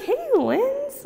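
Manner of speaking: high questioning hey. Retold or imitated. Sister wondering why Linz came in, high questioning. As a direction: ask why she is here, pitched high. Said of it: "like, questioning why I was there".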